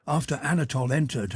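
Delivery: ordinary delivery, nothing marked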